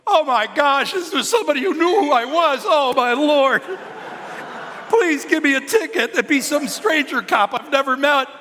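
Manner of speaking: high, loud, mock-panicked outburst; mortified panic. Pastor retelling his embarrassment at the known cop, loud high panic. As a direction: wail it like a caught man begging. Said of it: "and I'm like".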